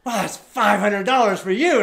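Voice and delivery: loud, high, impressed announcement; acting out an astonished boss; retold amazement. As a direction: proclaim the big payout in awe. Retells boss amazed at takings.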